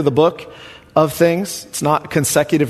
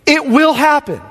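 emphatic proclamation; 10 semitones higher than usual. Acted. Preacher stresses certainty.

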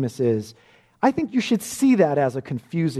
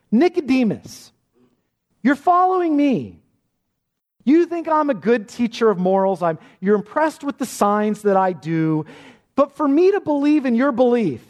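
raised, urgent pulpit voice; fervent preaching; 5 semitones higher than usual. Acted. Pastor speaking as Jesus to Nicodemus, fervent and urgent. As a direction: press the point home to Nicodemus.

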